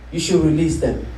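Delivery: ordinary talk, nothing marked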